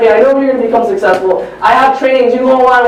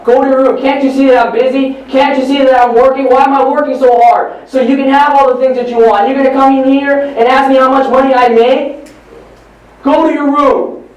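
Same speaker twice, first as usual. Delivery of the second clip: raised, high-pitched parental scolding; angry father; 5 semitones higher than usual. Acted. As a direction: tell off a child in exasperation. Father yells, sends son away.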